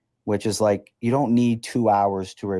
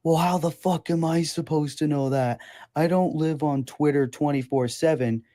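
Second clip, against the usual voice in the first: higher, sneering mock voice of a dismissive wrestler fan; 5 semitones higher than usual. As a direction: parrot him with a sneer. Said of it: "and is like".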